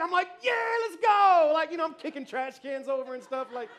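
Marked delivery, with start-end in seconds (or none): 0.4-1.5 loud, high, drawn-out celebration yell; reenacted roar of celebration; hyped cheering. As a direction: cheer like you just won. Retells his own cheering celebration.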